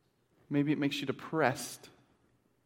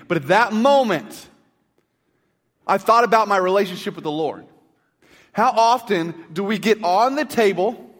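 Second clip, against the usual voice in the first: loud, high, impassioned sermon delivery; full-force preaching; 7 semitones higher, 8 dB louder than usual. Acted. Pastor urging congregation to obey God, loud preaching. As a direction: preach it hard to the whole church.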